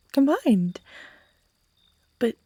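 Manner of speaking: normal talking voice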